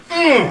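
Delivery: sudden high, loud grunt of effort; straining grunt. Retold or imitated. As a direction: imitate a hard grab with a strained grunt. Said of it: "So I went".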